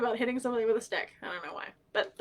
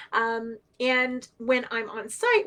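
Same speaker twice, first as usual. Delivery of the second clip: usual speaking voice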